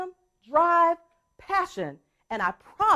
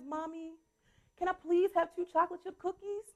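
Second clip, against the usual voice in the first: put-on pleading child; 4 semitones higher, 8 dB quieter than usual. Son pleads with mom for cookies, softly. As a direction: beg like a little boy. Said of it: "he looks at me with this serious face".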